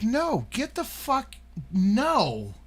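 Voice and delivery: high, drawn-out mock protest; incredulous refusal. Answering a stranger in a car who asks to party, high incredulous refusal. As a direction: stretch it out high in disbelief.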